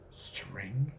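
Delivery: whispered, stunned; Ted Bundy, appalled at the string, whispering. Acted. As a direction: breathe it out in disbelief. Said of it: "noise made only by the terminally appalled".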